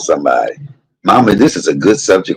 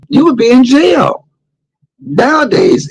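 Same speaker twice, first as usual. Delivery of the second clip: incredulous high. Real, not acted. Incredulous about jail.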